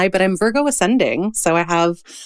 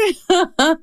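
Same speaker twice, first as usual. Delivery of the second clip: a burst of high nervous laughter; giddy high laugh; 10 semitones higher than usual. Real, not acted. Giddy laugh at admiring guest.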